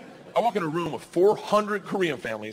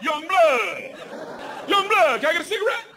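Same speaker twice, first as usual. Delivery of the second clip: staring creature; 12 semitones higher than usual. Acted. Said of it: "it's just staring at you like".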